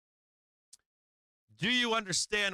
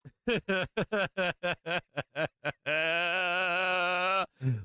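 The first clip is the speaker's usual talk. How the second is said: big laugh. Real, not acted. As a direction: crack up at the thought. Bursts out laughing at own point.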